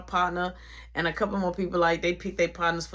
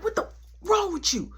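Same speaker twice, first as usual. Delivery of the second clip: heated confrontation; 10 semitones higher than usual. Retold or imitated. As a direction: act out demanding an answer. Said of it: "I'm yelling, I'm going off".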